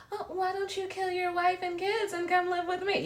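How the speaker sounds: very high, whiny pleading voice; hammed-up needy mistress; mock clingy side chick. Mocking a side chick urging him to leave his family, very high and whiny. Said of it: "They be like".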